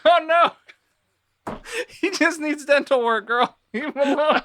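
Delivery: high mock-pleading voice, laughing at the end; pleading defence. Friend defending the ugly guy to the girl, high pleading voice. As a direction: beg her to leave him be.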